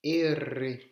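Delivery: R named as "erre", loud with a tongue trill; R roulé. Shown as a demonstration. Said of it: "qui n'ont pas du tout aimé cette lettre". (erre fortement roulé)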